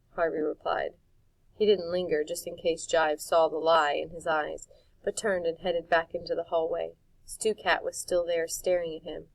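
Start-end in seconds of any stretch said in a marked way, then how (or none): none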